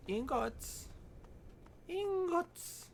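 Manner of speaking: high, slow, silly shouted call. Acted. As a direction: yell it out in a goofy high voice. Calling out in a silly voice.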